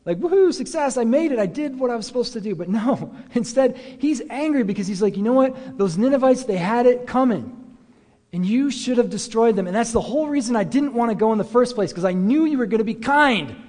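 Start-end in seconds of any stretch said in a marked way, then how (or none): 0.2-2.5 high, louder cheering Jonah; preacher voicing Jonah as if he were thrilled at success; mock celebration. Imagined happy Jonah celebrating. 5.1-13.5 higher, louder indignant complaint; preacher playing Jonah scolding God for being kind; yelling at God. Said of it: "He's, like, yelling at God".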